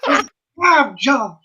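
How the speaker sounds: loud, high cowboy declaration; hammed-up outlaw shout. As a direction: belt it out like a dramatic western hero. Hamming movie cowboy lines.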